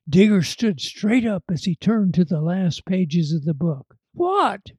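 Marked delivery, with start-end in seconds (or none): 4.1-4.7 anxious cry. A narrator's reading. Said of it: "cried Brady anxiously".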